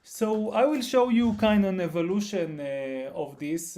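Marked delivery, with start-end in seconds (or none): 0.0-2.6 speaking louder. Real, not acted.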